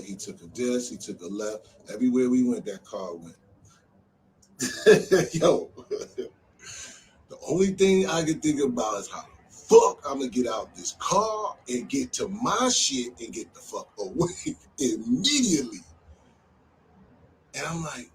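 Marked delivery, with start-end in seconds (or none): 4.6-5.3 big real laugh, louder and higher; loud burst of laughter. Real, not acted. Laughing at memory of car chase. 5.3-15.8 slow, higher, louder words broken by laughs; laughing through it. Real, not acted. Laughing while recounting escape plan.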